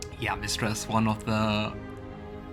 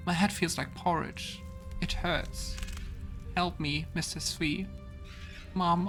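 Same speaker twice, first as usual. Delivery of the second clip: put-on higher-pitched, hurt little child voice; lost child's letter; 6 semitones higher than usual. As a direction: read it as a child pleading for Mom. Reads a child's letter to Mom.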